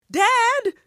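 loud, high call for dad. Acted. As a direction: shout for dad across the house. Kid calls out to father.

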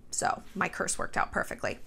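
hushed, whispered gloat; smug aside. Real, not acted. Gloating aside about the cheating boyfriend, whispered. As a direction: murmur it like a secret win.

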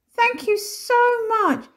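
high, loud, drawn-out gratitude; gushing thanks. A narrator's reading. Missus Plum thanking the family, high and gushing. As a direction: gush with relief, stretching the words.

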